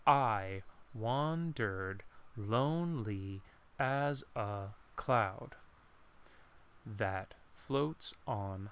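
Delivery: slow iambic reading, stressed beats marked; rhythmic stressed reading. Shown as a demonstration. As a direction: read it slow, letting the stressed beats land. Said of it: "you can see the flow".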